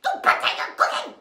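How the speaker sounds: loud, high, fast bossy yell. A narrator's reading. Stepsisters ordering Ellie to cook, loud bossy yell. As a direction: bark the order across the room. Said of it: "Her sisters yelled".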